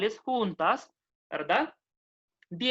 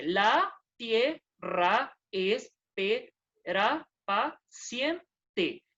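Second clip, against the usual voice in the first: "La tierra espera paciente" stretched very slowly, word by word; lento, marcando la sinalefa; slow syllable reading; much slower than usual. Shown as a demonstration. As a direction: read it out slowly, linking the vowels across words.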